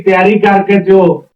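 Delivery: plain reading voice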